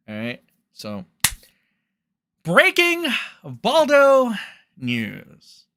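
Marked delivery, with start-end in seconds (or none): 2.4-5.6 big, slow news-jingle announcement, higher and louder; news announcer. Acted. Streamer opening his gossip segment as a hammed-up announcer. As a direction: announce it like a breaking news banner.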